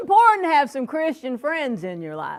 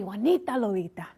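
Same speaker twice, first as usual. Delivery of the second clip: put-on Juanita Lolita with a tapped, Spanish-accented delivery; 5 semitones lower, 4 dB quieter than usual. Comedian teaching the crowd her name, in a Spanish accent. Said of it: "I don't talk like that".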